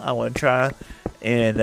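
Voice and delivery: normal talking voice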